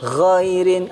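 Ghairin with a rough voiced gh; slightly raspy gh. Shown as a demonstration. Teacher demonstrating ghin, raspy upper-throat gh in Ghairin. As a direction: gargle the gh lightly in Ghairin. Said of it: "agak serak-serak gitu".

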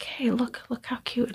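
soft unvoiced hush over the cute baby; whisper. Student tugging her skirt, whispering look how cute. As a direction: whisper it so only she hears.

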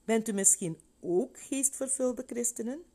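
put-on a girl hoping for a yes; eager little girl. A narrator's reading. Girl's voice in story. (gretig meisjesstemmetje, hoger)

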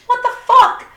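high, loud what the fuck in disbelief; shocked outburst. Retold or imitated. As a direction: shriek it up high, outraged. Wife reacts to the husband's excuse.

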